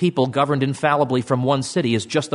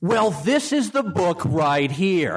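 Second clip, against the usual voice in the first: TV reporter; 4 semitones higher, much slower than usual. Retold or imitated. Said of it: "the reporter comes on".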